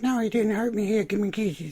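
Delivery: airy mocking impression; hammed-up high female voice; mock girly Maggie. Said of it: "she's like".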